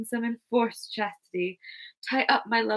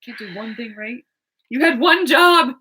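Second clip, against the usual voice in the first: mock angry scolding; 2 semitones higher, 8 dB louder than usual. Oberon scolding Puck.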